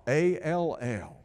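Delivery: "A L L" spelled slowly, letter by letter. Shown as a demonstration. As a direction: spell it out to drive the word home. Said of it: "with all your heart".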